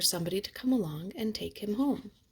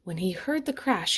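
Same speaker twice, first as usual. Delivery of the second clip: ordinary delivery, nothing marked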